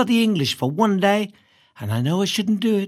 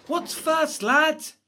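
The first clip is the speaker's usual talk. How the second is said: higher schoolboy's question to classmates; schoolboy asking; 7 semitones higher, much slower than usual. A narrator's reading. Boy asking classmates about first class, pitched up. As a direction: toss out a question to the lads, pitched up.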